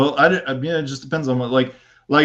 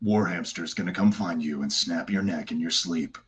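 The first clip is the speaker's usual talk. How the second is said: bad guy voice; 2 semitones lower than usual. Shown as a demonstration. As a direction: threaten them like a dark TV promo. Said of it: "Can you do one saying".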